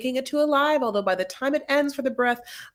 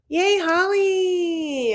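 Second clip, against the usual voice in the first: high, slow delighted yay; real excited high cheer; 10 semitones higher, 4 dB louder, much slower than usual. Real, not acted. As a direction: cheer for a viewer by name. Cheering viewer in the chat.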